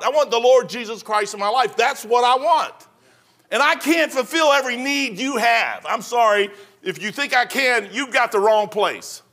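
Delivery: full-force preaching to the congregation. Acted. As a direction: drive it home from the pulpit.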